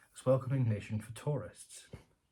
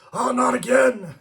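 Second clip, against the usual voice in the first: much higher, louder, slow groan of exasperation; exasperated; 13 semitones higher, 13 dB louder, slower than usual. Retold or imitated. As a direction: howl it like the host who's heard it too often. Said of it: "And he's like".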